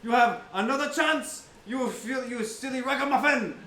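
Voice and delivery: loud, high pompous warning; hammed-up indignant highwayman; scolding swashbuckler. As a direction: scold them with theatrical bravado. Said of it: "He goes".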